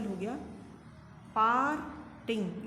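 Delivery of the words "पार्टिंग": "पार्टिंग" slowly in two parts, पार, then टिंग; split into syllables. Shown as a demonstration. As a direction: stretch and break "पार्टिंग" after पार. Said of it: "part में बोल रहे हैं".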